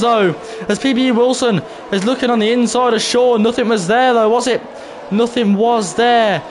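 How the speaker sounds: high-pitched excited play-by-play; live race commentary. Acted. Streamer calling sim racers side by side, excited commentary. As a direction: call the wheel-to-wheel action, pitch up high.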